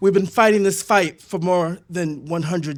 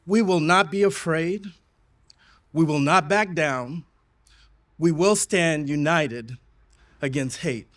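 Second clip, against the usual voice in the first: rally speech. Real, not acted. Rally chant opening the speech.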